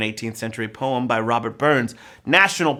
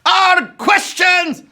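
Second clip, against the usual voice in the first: hammed-up Scottish accent, shouted high and loud; 6 dB louder, much slower than usual. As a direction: roar it like a Scottish rebel in a bad Scottish accent. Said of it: "I was gonna try a Scottish accent".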